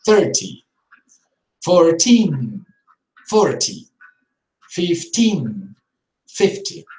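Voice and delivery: put-on "Thirty" on thir, "Fourteen" on teen; stress contrast. Shown as a demonstration. Teacher drilling number stress pairs. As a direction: punch the stressed syllables clearly. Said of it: "stressing the first one".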